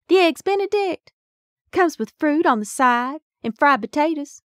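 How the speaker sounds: high, loud Southern Mississippi drawl; heavy Mississippi drawl. A narrator's reading. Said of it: "in her heavy drawl".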